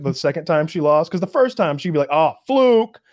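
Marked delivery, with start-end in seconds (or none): 2.1-3.0 mock sore loser. Sore loser excuses, higher.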